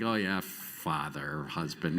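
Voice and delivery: low, slow, offhand mumble of minor titles; dismissive afterthought. Acted. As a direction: read it low and slow, as if it barely matters. Afterthought on back of tombstone.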